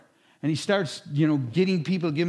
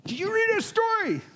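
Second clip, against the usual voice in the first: much higher, urgent burst; excited question; 3 dB louder than usual. Retold or imitated. Joe to his pastor, an excited high question. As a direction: burst in waving the newspaper.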